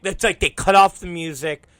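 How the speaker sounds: ordinary talk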